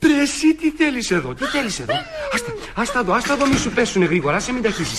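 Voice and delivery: high-pitched, stammering alarm; flustered shock. Acted. Master finds the girl serving in his in-laws' house, flustered shock. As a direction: blurt it out repeatedly, thrown off balance.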